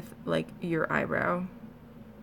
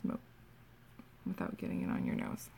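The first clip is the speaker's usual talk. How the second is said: whispered; 11 dB quieter than usual. Real, not acted. Concentrating aside.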